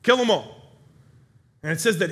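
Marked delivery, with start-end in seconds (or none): 0.0-0.8 high, loud command, repeated; acted kingly command at raised volume; barked order. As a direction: shout the order to your men as a raging king. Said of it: "he orders his men".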